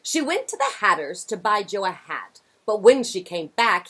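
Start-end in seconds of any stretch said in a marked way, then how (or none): none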